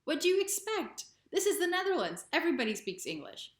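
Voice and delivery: high-pitched, incredulous retort; mock astonishment, pitched well up; almost shocked. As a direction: imitate shocked locals stating the obvious. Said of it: "almost shocked".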